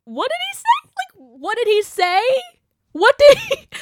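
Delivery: squeaky, high gasp of disbelief; can't believe what he wrote; stunned disbelief. Real, not acted. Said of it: "shocked at the quoted post".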